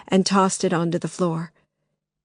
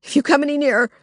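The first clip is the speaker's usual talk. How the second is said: warning; 8 semitones higher, 3 dB louder, much faster than usual. Acted. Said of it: "Lily warned him".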